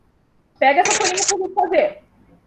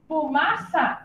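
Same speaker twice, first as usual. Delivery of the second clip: "Fumaça" slow and soft, as fu, ma, ça; syllable by syllable; 7 dB quieter, much slower than usual. Shown as a demonstration. Teacher joining syllables for pupils, "Fumaça" slowly, syllable by syllable. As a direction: softly space out the three syllables of "Fumaça". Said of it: "é a sílaba fu".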